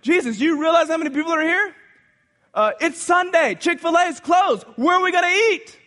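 high, loud, hurried worrying; loud fretful disciple asking Jesus; anxious Philip. Acted. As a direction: panic about lunch for the crowd. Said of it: "he's even thinking ahead".

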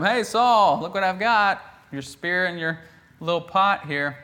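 taunting call from far away; mock taunt to Saul. David calls out to Saul.

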